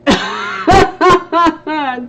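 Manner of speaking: loud, high burst of laughter; real, delighted cackle; laughing. Real, not acted. Laughing at a friend's comment.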